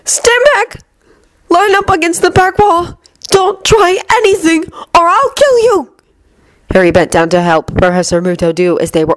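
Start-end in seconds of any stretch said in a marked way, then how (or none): 0.0-5.9 high, nervous shouted orders; shaking voice. A narrator's reading. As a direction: bark orders with frightened urgency. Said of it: "Draco's shaking voice".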